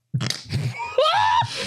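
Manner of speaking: very high burst of laughter; shrieking laugh. Real, not acted. Game master at chair blocking the door, shrieking laugh. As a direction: crack up in a high squeal.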